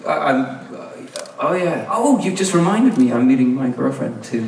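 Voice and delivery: stumble, then pitch up on a pretend realization; hammed-up "oh yeah" recovery, falling back to normal; faked sudden remembering. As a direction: cover the awkwardness with a made-up excuse. He fakes a sudden recollection.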